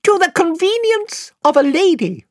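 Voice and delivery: very high, piping, courtly little voice; shrill piping mouse. Acted. Reepicheep greeting Queen Lucy in a piping voice. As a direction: pipe it like a tiny gallant knight. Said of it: "said Reepicheep".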